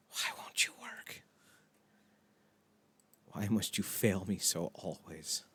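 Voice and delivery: pleading with a machine. Real, not acted. Begging the stream to work.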